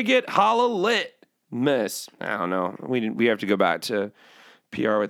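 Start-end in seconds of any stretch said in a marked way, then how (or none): none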